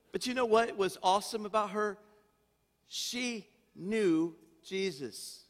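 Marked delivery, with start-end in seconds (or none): 2.9-5.4 slow emphasis. Acted. Slow emphatic sermon point.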